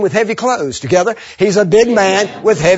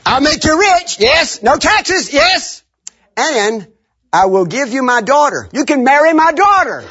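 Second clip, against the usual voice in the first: excited recap; 8 semitones higher than usual. Retold or imitated. King's offers recapped excitedly.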